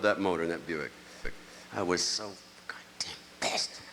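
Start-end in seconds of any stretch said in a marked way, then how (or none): 1.7-3.8 whispered, slow and furious; seething whisper. Retold or imitated. Telling the crowd about the engine bill, in a slow whisper. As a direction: hiss it through the anger.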